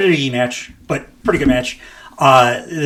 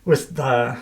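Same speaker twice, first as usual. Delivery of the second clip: put-on German accent; 4 semitones higher, 4 dB quieter, slower than usual. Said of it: "I just wanna do a German accent".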